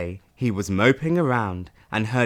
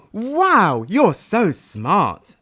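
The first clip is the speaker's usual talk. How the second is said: high, louder, slow admiring gush; impressed dragon; 6 semitones higher, 4 dB louder, much slower than usual. Acted. Dragon praises Sad for counting, slow and admiring. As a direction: marvel at him slowly. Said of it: "said the dragon".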